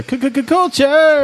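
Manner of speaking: high stuttered announcer call. Acted. Host introducing the culture segment, announcer stutter. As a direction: hype it like a show jingle.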